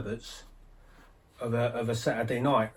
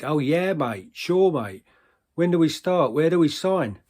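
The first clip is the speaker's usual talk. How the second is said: raised, eager tipsy agreement; enthusiastic; 4 semitones higher, 5 dB louder than usual. Acted. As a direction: jump at the offer, louder and keener. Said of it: "getting all enthusiastic".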